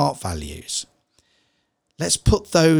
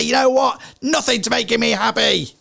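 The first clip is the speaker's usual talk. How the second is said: stressed exasperation; 9 semitones higher than usual. Retold or imitated. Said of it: "In those moments of stress".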